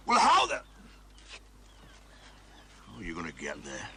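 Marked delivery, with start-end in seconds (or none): 0.1-0.6 much higher, loud outburst; sharp shout. Acted. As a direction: bark the question in frustration. Exasperated sergeant snaps at captain.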